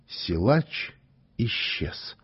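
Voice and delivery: usual speaking voice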